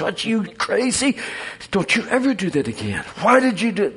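a hen mother telling off her reckless chick; mother hen scolding. Acted.

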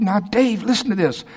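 high, eager pitch of a man bursting with news; lightly acted eager pitch; excited. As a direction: rush in thrilled to share an idea. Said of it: "And I'll go in excited".

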